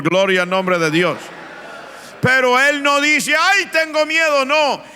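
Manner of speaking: loud, fervent sermon delivery; impassioned pulpit preaching; full-force preaching. Acted. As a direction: preach it with fire to the congregation.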